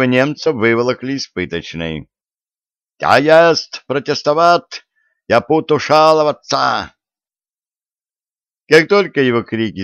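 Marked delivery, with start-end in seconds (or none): 3.0-6.9 put-on high, loud protest in a German accent. A narrator's reading. (возмущённо, с немецким акцентом)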